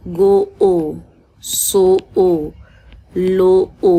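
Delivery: गो, सो, लो with each ओ drawn out long and loud; stretched long o. Shown as a demonstration. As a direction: hold the ओ in गो ओ, सो ओ, लो ओ. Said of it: "ओ stretch हो रहा है". (ओ को खींचकर, ज़ोर से)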